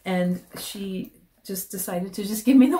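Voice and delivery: ordinary delivery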